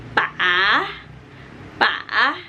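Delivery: pa falls then rises; third tone, dip and rise. Shown as a demonstration. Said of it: "dia dibacanya misalnya". (nada ketiga, turun lalu naik)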